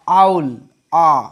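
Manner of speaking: slow, drawn-out आउल then आ with an open "aa" vowel; sound demo. Shown as a demonstration. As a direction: stretch आउल slowly and land on a long open आ. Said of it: "लेकिन इसका sound आ रहा है आ".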